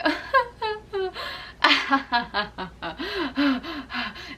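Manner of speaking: high laughter tipping into a sob; dramatic laugh into crying. Shown as a demonstration. Coach showing how laughing spills into crying, laugh-sob. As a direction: let the laugh break into tears. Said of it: "just as dramatic as you can be".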